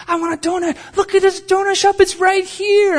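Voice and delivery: very high, excited squeal of a plea; hammed-up high-pitched enthusiasm; mock high-energy wife. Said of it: "she's totally opposite energy".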